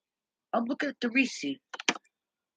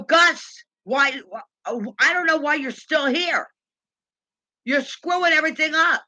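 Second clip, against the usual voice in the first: raised pitch, indignant complaint; fed-up customer telling off the manager, voice climbing; retold exasperated scolding; 5 semitones higher, 4 dB louder than usual. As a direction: chew out the useless manager.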